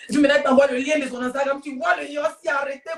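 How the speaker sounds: ordinary talk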